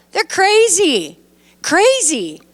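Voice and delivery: drawn-out, disbelieving "crazy", pitched high; exasperated "crazy". Real, not acted. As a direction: shake your head at how crazy it is. Teacher reacts to stoning plan.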